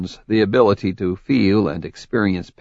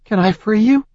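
frightened; 12 semitones higher than usual. Acted. Frightened heroine in darkness.

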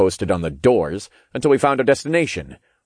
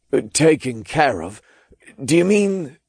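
nervous man voice; 7 semitones higher than usual. Acted. Zeitman nervous question.

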